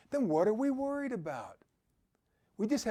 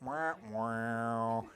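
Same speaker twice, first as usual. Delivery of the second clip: low mock sad-trombone "wah wah"; a hint of a mopey wah-wah sound; 10 semitones lower, 7 dB quieter than usual. Mocking Debbie Downer for listeners, low mock wah-wah sound. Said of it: "Debbie Downer".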